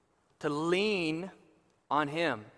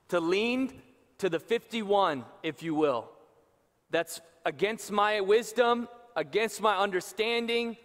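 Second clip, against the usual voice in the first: louder, higher, slowed preaching; full-force preaching; 5 semitones higher, 5 dB louder than usual. Acted. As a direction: build the call with rising fervour.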